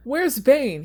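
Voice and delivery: high, loud frantic question. Acted. Stryver shoved into a chair, frantically asking for Bane. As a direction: desperately call for your protector. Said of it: "he asked frantically".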